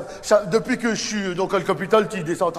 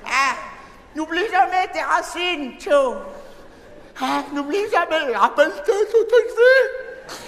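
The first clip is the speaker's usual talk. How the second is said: higher, laughing mum giving advice; pitched-up proud mother, half laughing; mother reminding her son of his roots, higher; mother's voice; 6 semitones higher than usual. Retold or imitated. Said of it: "ça va y aller, hein".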